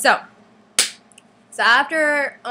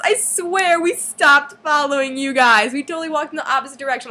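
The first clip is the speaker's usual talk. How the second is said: acted protest; 5 semitones higher, 5 dB louder than usual. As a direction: plead innocence loudly, imitating an indignant friend. Friend protests to Preston, acted.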